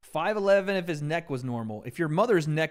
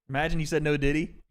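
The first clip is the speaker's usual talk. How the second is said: ordinary talk, nothing marked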